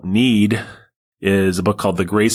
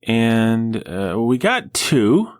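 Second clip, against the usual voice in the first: mock reasoning; 8 semitones higher than usual. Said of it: "because we said".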